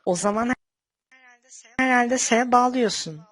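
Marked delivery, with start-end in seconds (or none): 1.8-3.2 loud mocking. Mocking the boast.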